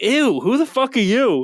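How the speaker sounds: high, mock snooty girl; mocking a disgusted Instagram model. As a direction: sneer like an offended influencer. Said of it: "these bitches will be like".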